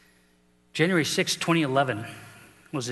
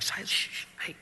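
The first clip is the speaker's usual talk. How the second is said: hushing; 6 dB quieter than usual. Retold or imitated. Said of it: "He's like".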